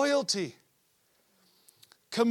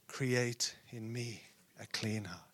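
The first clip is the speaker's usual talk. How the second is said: slow, hushed psalm recital; very soft, low plea to God; whispered prayer; 10 semitones lower, 10 dB quieter, slower than usual. Retold or imitated. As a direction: whisper it slowly as a penitent prayer. Recites David's prayer of repentance.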